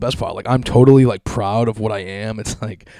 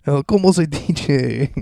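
slow, higher fake Spanish accent; mock Spanish; 4 semitones higher, 3 dB louder, slower than usual. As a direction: mimic the faker again. Mocking her again.

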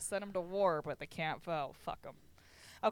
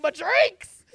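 mock wail; 11 semitones higher, 16 dB louder than usual. Mock lament over drinks.

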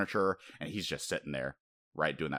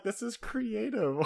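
high, slow, marveling "This is creative"; amazed delight; 13 semitones higher, 3 dB quieter, slower than usual. Retold or imitated. Fan gushing to a co-host, high and slow with delight. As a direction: gush over the clever move, drawn out and high. Reacting to a clever fight scene.

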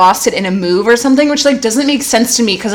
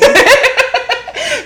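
high cackling laugh. Real, not acted. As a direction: break into a shrieking laugh. Big laugh at own story.

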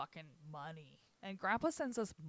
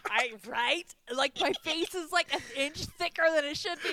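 loud, high, excited through laughter; laughing; 8 semitones higher, 11 dB louder than usual. Real, not acted. Agreeing with friend about the absurd mask photo, laughing. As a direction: exclaim it while cracking up.

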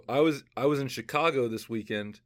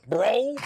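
high, punched BRO like an expletive; super-hard BRO; 8 semitones higher, 4 dB louder than usual. Retold or imitated. Imitating how the Uber driver ended sentences, super-hard bro. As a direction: curse the word "bro" at them. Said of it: "almost an expletive the way he said it".

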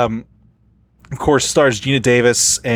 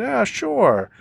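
big high-pitched imitation voice; Gary Marshall; 12 semitones higher than usual. Director happily agrees to a fan's photo, big imitated voice. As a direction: agree in a big, far higher voice. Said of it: "In that big Gary Marshall voice".

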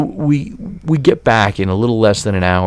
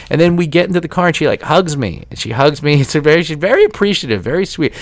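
usual speaking voice

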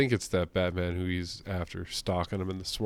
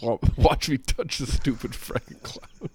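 mocking dumb hero; 5 semitones higher, 5 dB louder, slower than usual. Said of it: "It's like".

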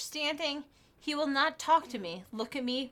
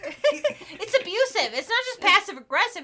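indignant; 5 semitones higher, 6 dB louder than usual. Real, not acted. Emphatic outrage at the letter.

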